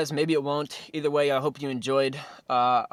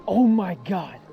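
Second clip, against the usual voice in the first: excited; 6 semitones higher, 5 dB louder, slower than usual. Real, not acted. Landing the fish.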